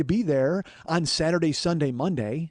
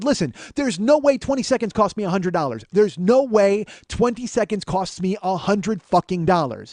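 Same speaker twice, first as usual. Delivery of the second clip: louder, higher heated insistence; angry rant; 5 semitones higher, 4 dB louder than usual. Retold or imitated. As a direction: insist hard, losing patience. Arguing with the agent.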